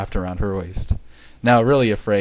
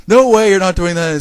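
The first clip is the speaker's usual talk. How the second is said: high, loud protest; indignant refusal; 8 semitones higher, 8 dB louder than usual. A narrator's reading. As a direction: refuse it outright, raising the voice. Character protests diaper check.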